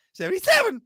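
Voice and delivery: announcer call. Acted. Announcer-style call of number.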